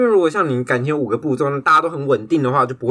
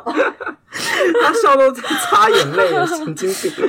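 after laughing hard; 11 semitones higher than usual. Real, not acted.

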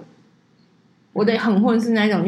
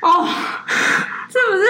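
shocked outcry; 10 semitones higher, much slower than usual. Real, not acted. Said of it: "我当下真的傻眼了".

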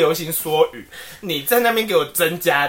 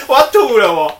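ordinary talk

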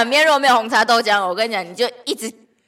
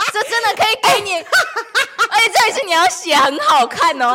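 higher, louder excited promise; excited laughing; 9 semitones higher, 6 dB louder than usual. Real, not acted. Promising a million for toe calligraphy, excited laughing. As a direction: laugh while daring her.

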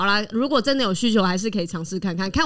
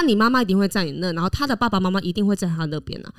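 ordinary talk

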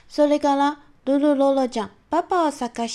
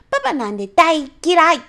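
much louder, higher, faster pouting shout; angry child; 5 semitones higher, 7 dB louder than usual. Acted. Sulky child yelling at dad she hates him, loud. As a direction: snap back at dad in a huff. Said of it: "大きな声で言う".

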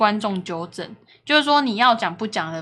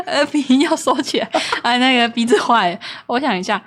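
ordinary talk

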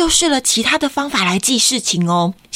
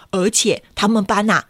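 ordinary delivery, nothing marked

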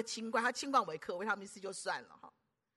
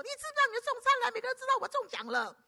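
high, fast, loud scolding; shrill-free rapid telling-off in a much higher voice; scolding neighbour; 14 semitones higher, 5 dB louder, faster than usual. Retold or imitated. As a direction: snap at him like an angry neighbour. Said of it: "都被人家骂说".

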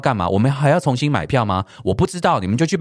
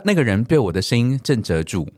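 ordinary delivery

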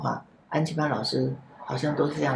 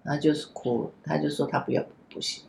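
ordinary talk, nothing marked

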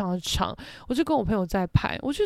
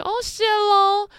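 much higher, slow, airy throwaway thanks; breezy thanks; 11 semitones higher, 3 dB louder, slower than usual. Retold or imitated. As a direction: toss off a casual cheers to a stranger. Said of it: "我就".

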